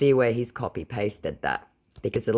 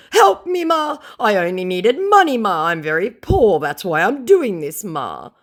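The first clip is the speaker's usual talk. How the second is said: very high, loud pleading whine; begging scammer; 10 semitones higher, 9 dB louder than usual. Retold or imitated. Scammer begging her in a very high whine. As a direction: beg Ma desperately.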